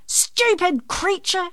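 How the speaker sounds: high, loud, drawn-out scolding; angry yell. A narrator's reading. Auntie May at the squawking rooster, yelling crossly. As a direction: yell it slowly at a pest, exasperated. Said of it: "she yelled".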